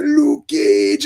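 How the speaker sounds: high, far-off mock Jamaican-accented call; mock Jamaican villain calling out his enemy; imitating Bushmaster. Imitating Bushmaster calling Luke Cage.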